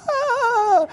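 very high crying wail; little brother's squall. Retold or imitated. Pastor imitating little brother's crying squall. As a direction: wail like a scared toddler. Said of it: "he starts to squall, crying out".

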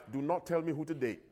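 dropped quiet, a stubborn muttered condition; grudging aside. Retold or imitated. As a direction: tack the catch on softly, under the breath. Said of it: "Here's how some people surrender themselves".

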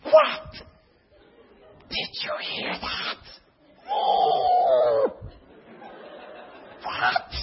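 very high, breaking squeals of disbelief; astonished gasping. Retold or imitated. New churchgoers reacting to the pastor, squealing in amazement. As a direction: gasp and squeal as if hearing a revelation.

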